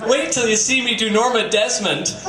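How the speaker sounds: ordinary talk, nothing marked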